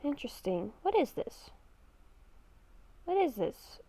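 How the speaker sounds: normal talking voice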